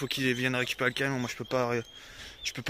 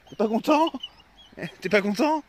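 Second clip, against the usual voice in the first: high cooing rise with a laugh; 6 semitones higher, 5 dB louder than usual. Real, not acted. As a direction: coo at the sulking dog, high. Baby talk to dog.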